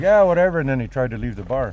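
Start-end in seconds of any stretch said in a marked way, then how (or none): 0.0-0.6 high, loud careless shrug-off; dismissive Duncan. Retold or imitated. Buddy dismissing the angry narrator, high and loud. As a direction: toss it off, pitched up and loud.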